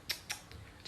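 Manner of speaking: tongue clicks of disapproval; soft disapproving tongue clicks; disapproving tsk. Real, not acted.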